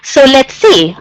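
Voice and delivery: ordinary delivery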